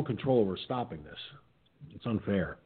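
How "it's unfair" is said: normal talking voice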